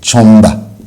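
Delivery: छमदा said as chhom-da with a falling end; pronunciation shown. Shown as a demonstration. Teacher models the pronunciation for students, clear and falling. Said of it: "इसका तलफ़ुज़ क्या बनेगा".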